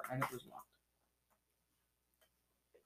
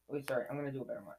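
ordinary delivery

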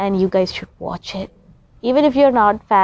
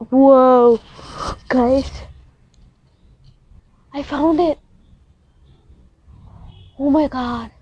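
amazed; 3 semitones higher than usual. Real, not acted. Spotting Scorpio constellation live.

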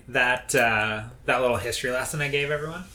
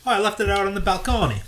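Oh with a high, hammed-up Italian lilt; mock Italian accent; 7 semitones higher, 3 dB louder than usual. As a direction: play a cartoon Italian host. Italian-accent joke about balcony.

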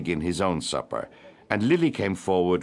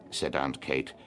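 ordinary delivery, nothing marked

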